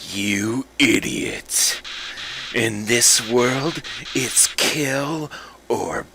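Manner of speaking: slow, menacing whisper; evil Flowey. Acted. Flower revealing its true self, slow sinister whisper. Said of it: "my superb voice acting".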